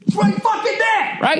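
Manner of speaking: high strained yell of frustration; frustrated yell. Retold or imitated. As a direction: yell it high, nearly cracking. Raging at traffic jam.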